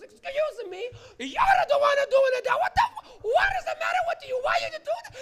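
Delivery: put-on shrieking, very high old Italian lady; loud hammed-up outraged screech; pissed old lady. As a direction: scream it as a furious old woman. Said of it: "fucking pissed".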